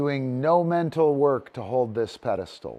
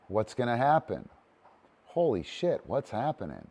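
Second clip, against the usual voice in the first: ordinary talk, nothing marked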